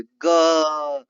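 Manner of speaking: a loud buzzing ga from the throat; ग with the throat buzzing loudly; voiced ga demo. Shown as a demonstration. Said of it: "आप ग को बोलिए". (गले से गूंजता ग)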